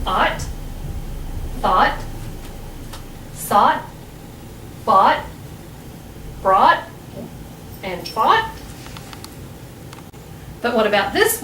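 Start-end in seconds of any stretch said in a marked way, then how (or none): 0.1-8.5 "ought, thought, sought, bought, brought, fought" each with an aw vowel and silent gh; word list from "ought" to "fought", each separate, rhyming on awt; aw sound. Shown as a demonstration. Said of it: "like it's pronounced in ought".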